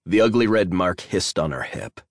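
ordinary delivery, nothing marked

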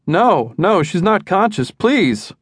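high, pleading no's; plead, voice climbing; husband pleading with the dispatcher, high; desperate. A narrator's reading. Frantic husband calling 911.

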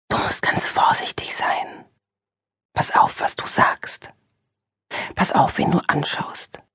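slow, hushed, urgent whisper; slow warning whisper. Acted. Inner voice urges Julia to be careful, slow whisper. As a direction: whisper each caution slowly.

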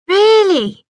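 put-on high, loud eager boy; thrilled little boy asking; excited. A narrator's reading. Boy thrilled about fishing trip.

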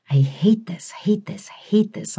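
whispered, repeated under the breath; hushed, tense murmur. A narrator's reading. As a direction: mutter it to yourself in dread. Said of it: "I murmured".

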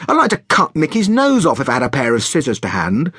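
mildly venomous telling-off, pitch lifted; a touch of biting exasperation; out of patience. A narrator's reading. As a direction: shopkeeper finally letting rip at a child. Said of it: "Sarah's patience had run out".

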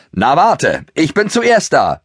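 whinny it to your friend as you race; pony whinnying a playful challenge in a race. Acted. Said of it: "wieherte er".

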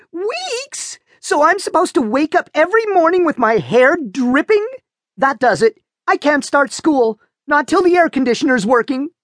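put-on outraged teen. A narrator's reading.

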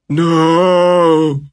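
high, loud cry of no; panicked shout. A narrator's reading. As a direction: scream it in panic. Vincent panics against the dragon.

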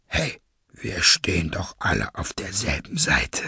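low, breathy, measured menace; cold masked villain. Acted. Masked leader calms his gang, low and breathy. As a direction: soothe condescendingly in a low villain voice.

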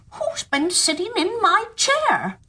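high, slow, squeaky little dwarf voice; first dwarf. Acted. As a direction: ask slowly in a tiny high dwarf voice. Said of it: "The first one said".

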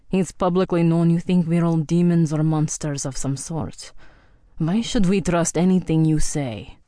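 Viking warrior, cold accusation, "never" hammered; a suspicious old Viking accusing her; hostile Viking. Acted. Andor voiced in story.

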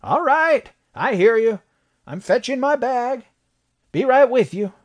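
very high, strained holler; doctor calling out through a door; shouting from inside. A narrator's reading. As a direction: holler that you're on the way. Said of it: "heard a man within shout".

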